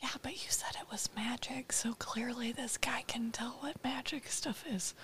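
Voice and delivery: unvoiced whisper to a friend. Real, not acted. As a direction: hush it so the shopkeeper can't hear. Players whisper at the table.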